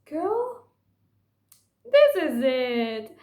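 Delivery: put-on high, loud, slow-drawn "Girl, this is it"; excited squeal. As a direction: squeal it like a thrilled fan. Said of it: "I'm like".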